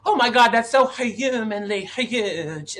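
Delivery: slow, high mock New York drawl; mock New York accent. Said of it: "mocking New Yorkers".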